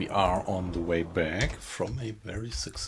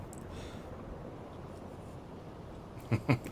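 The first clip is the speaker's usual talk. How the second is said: laugh; 6 semitones higher, 7 dB quieter than usual. Real, not acted. Streamer laughs at chat.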